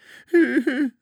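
high, loud wailing cry; wailing sob. Acted. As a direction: let out a frightened wail. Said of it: "huilde bange Toontje". (hoog jammerend gehuil)

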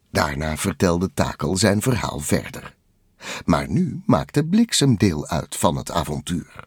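ordinary delivery

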